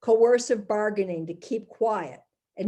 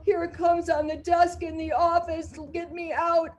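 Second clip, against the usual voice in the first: higher, pleading victim voice; frightened young woman; 7 semitones higher than usual. Acted.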